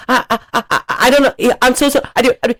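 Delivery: high, stammering panicked voice; flustered stammer. Retold or imitated. Caught creep stammering back to the angry dad, high and flustered. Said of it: "the guy came back and was like".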